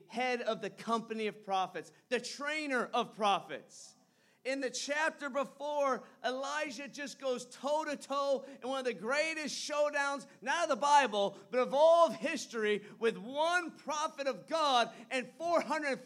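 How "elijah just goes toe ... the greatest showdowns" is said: ordinary talk, nothing marked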